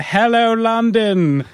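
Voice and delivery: high shouted German announcer; German accent. Retold or imitated. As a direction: call out cities in a German accent. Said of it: "shouting out the names of cities in a German accent".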